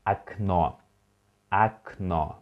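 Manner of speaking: okno said as AK-NO, first O like A, last O stressed; Russian word modelled. Shown as a demonstration. Teacher models okno for students to repeat, clear and careful. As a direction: read okno with the unstressed O as A. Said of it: "O is unstressed and O stressed".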